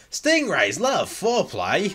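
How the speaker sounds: slow, high, loud swooping narration; hammed-up wildlife documentary voice; mock nature program presenter. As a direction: draw it out like an absurd nature narrator. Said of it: "on a nature program".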